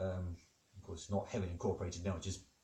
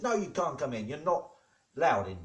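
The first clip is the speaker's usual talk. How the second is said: higher, emphatic mock-indignant vicar; firm refusal; 5 semitones higher, 5 dB louder than usual. Vicar bars Higgs boson from church, firm indignant refusal. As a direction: refuse entry, acting out the argument. Vicar argues back in joke.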